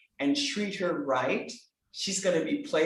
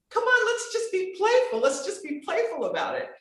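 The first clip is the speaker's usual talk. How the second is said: high, lively coaxing voice, higher in pitch; playful coaxing; 12 semitones higher than usual. Retold or imitated. Coach voicing a client's new mindset, high playful coaxing. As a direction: lift the voice way up and coax them into fun.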